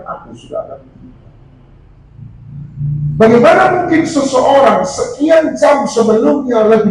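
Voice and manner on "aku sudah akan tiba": whispered and fast; hushed. Acted. Jesus warning the disciples the betrayer comes, whispered. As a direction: let it fade to a whisper.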